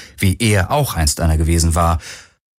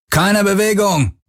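high, drawn-out shouted command; threatening shout; bellowed order; 11 semitones higher, slower than usual. A narrator's reading. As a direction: yell at them to freeze. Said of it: "brüllte einer von ihnen".

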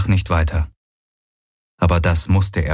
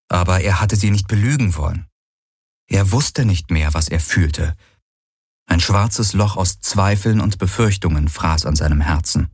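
usual speaking voice

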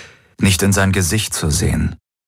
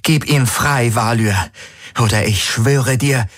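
raised, higher-pitched angry threat; threatening; 6 semitones higher than usual. A narrator's reading. Woman threatening Valyr to free her friend, angry. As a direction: demand it with rising anger.

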